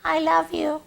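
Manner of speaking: high, soft mock giveaway line; mock tell. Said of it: "you're gonna go".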